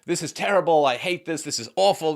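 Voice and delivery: loud, high mock griping; hammed-up disgusted complaint; acted complaint. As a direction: act out a player venting. It's one thing to say.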